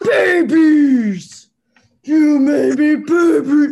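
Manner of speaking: loud, high, slow monster mother wail; mutant snapping turtle. Retold or imitated. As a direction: wail it like a frantic mutant mom. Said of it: "the mutant snapping turtle when she's like".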